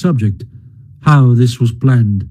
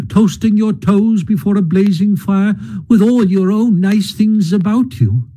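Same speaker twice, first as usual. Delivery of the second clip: higher, miserable lament; dismal self-reproach; 6 semitones higher than usual. A narrator's reading. Mole laments bringing Rat home, higher and dismal. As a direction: blame yourself aloud, forlorn. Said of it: "he cried dismally".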